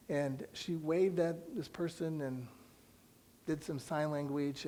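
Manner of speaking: ordinary delivery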